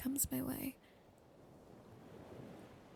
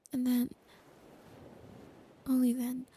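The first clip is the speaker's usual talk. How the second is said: whisper. Acted. Girlfriend promising her partner trust and marriage, whispered. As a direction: close intimate ASMR whisper throughout.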